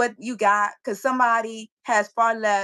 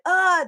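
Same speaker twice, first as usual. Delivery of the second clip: mock disgusted uh; 8 semitones higher, 5 dB louder than usual. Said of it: "They were like".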